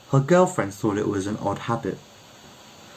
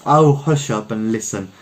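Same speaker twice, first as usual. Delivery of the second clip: usual speaking voice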